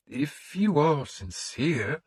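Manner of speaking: the priest questioning a pagan lord; wary priest. A narrator's reading. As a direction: probe him gently as the suspicious priest.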